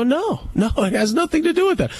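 high, fast, protesting no; arguing with the woman at the field, fast and high; emphatic denial. Retold or imitated. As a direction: brush the accusation off quickly. Retold argument, denying.

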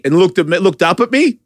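ordinary talk, nothing marked

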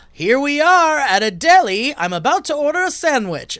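comedian voice. Acted. Person setting up robot bit.

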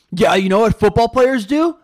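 mock the football players, puffed up and loud; loud bragging jock impression; jock bro voice. Mocking football jocks in high school.

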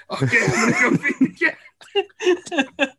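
slow, drowsy, laughing; sleepy laughing. Retold or imitated. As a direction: drag it out half asleep, giggling. Half-asleep son staggering out.

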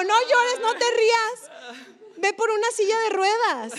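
regaño agudo y algo fuerte, recreado; orden apremiante imitada. Retold or imitated. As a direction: imita a una herida dando órdenes. Se imita dándole órdenes a Roger. (regañando con urgencia, tono agudo)

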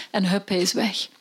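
ordinary delivery, nothing marked

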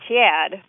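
overdone Chicago "Chad", the a lifted and stretched. Shown as a demonstration. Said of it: "he's not radically raised".

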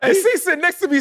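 high-pitched laughter breaking through; real laughter, voice jumping high; laughing. Real, not acted. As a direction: crack up while telling it. Laughing at coach falling.

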